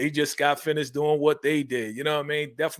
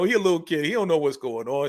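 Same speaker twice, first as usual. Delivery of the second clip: normal talking voice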